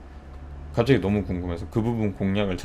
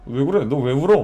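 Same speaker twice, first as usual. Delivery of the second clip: higher, louder worried mother; mom's alarmed question; 6 semitones higher, 6 dB louder than usual. Retold or imitated. Mother alarmed to see her grown son crying, higher and louder. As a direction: voice mom startled and fussing, raised. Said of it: "막 이러는 거야, 어머니도".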